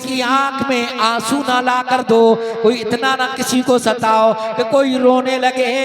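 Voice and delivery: loud ringing sermon delivery, sustained pitch; full-force preaching. Acted. Preacher warns oppressors of the day of reckoning, full-force preaching. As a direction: preach it out to the whole congregation.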